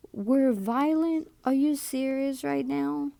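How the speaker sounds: can't believe what she just heard; indignant disbelief. Real, not acted. Telling viewers about a nurse's remark, indignant disbelief. Outraged at nurse calling patients violent.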